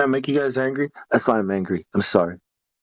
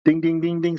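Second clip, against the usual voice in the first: higher, evenly repeated dings; imitated school bell; 4 semitones higher than usual. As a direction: chime like a bell on one pitch.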